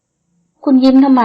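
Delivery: annoyed man asking why she smiles; displeased question. A narrator's reading. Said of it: "ถามขึ้นมาอย่างไม่พอใจ".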